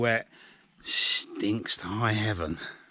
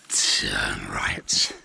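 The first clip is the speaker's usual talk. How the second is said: whisper. Real, not acted. Aside during walk directions.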